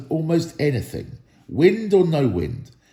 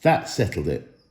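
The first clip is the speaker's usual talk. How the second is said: plain reading voice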